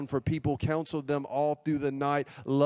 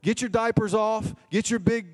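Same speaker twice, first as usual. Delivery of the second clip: high, emphatic pulpit exhortation; forceful preaching; 5 semitones higher, 3 dB louder than usual. Real, not acted. Preacher urging congregation to forgive like Jesus, forceful exhortation. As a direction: drive it home in a raised preacher's pitch.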